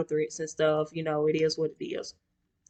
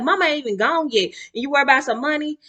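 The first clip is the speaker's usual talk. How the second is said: higher, indignant retort; mad; 8 semitones higher, 3 dB louder, faster than usual. Retold or imitated. Daughter snapping at her brother over money, indignant. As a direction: throw it back at him, outraged. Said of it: "So I got mad".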